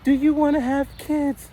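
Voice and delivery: very high squeaky imitation of her pestering; high mocking girlfriend voice. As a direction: pitch way up as the nagging girlfriend. Mimicking girlfriend.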